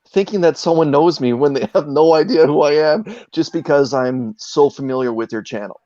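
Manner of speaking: usual speaking voice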